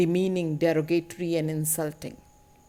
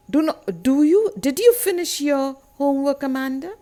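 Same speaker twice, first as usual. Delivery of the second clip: mock nagging parent, louder and higher; humiliating parental tone; 6 semitones higher, 6 dB louder than usual. Parent questioning Amanda about her homework, nagging. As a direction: question the child insistently, pitched up. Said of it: "these humiliating tone".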